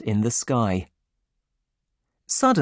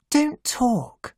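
put-on much higher hushed young woman; high, urgent hush; Jordan's voice; 12 semitones higher than usual. A narrator's reading.

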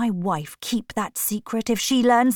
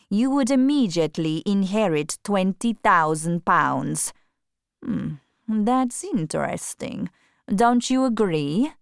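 sly count. A narrator's reading. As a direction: let the idea hang, sly and easy. Count suggests the wife's death.

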